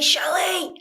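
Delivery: high call across the water; yelling her name toward the beach. Retold or imitated. Calling out to Shelly far off.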